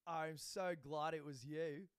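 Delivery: slow, drawn-out gushing imitation; put-on sweet-talking attendant voice; schmoozing. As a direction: lay it on thick over the phone. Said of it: "starts schmoozing a little".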